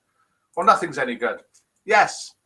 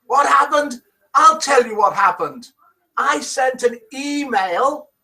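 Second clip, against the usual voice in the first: high, hammed-up Irish accent sliding into Welsh; worked-up Irish-Welsh lilt, pitched high; attempted Irish accent gone Welsh; 9 semitones higher, slower than usual. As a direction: rage in a bad Irish accent. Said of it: "He's gone Welsh now".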